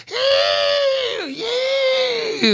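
very high, long falsetto howl; mock group cheer. Imitating stoner mates cheering in unison, high drawn-out whoops. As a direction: stretch "yeah" into a squealed whoop. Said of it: "like, in unison".

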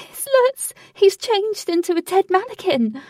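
high, loud panicked cry; frantic cry. A narrator's reading. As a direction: cry out in horrified alarm. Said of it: "Frantically she cried".

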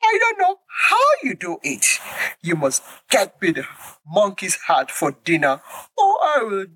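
high, whining alligator wife near tears; crying wife alligator. Acted. Wife alligator wailing she will die without the heart, high whine. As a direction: wail your demand like a spoiled wife. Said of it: "cried Missus Alligator".

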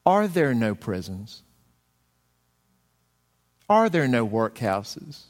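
slow, stress on are, rising questions; ghost throwing the words back. Retold or imitated. Ghost turns Scrooge's words against him, slow and pointed. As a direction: hand his own words back to him like a dagger. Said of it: "do you remember what the ghost said?".

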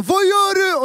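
high-pitched retold shriek; high mock scream of alarm; mock startled scream. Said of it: "Och jag skriker, han skriker".

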